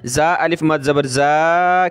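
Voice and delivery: final जा drawn out as a long "zaa"; stretched madd. Shown as a demonstration. Teacher spelling the madd for students, long जा. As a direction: hold the vowel of the last जा. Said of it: "खींचेंगे".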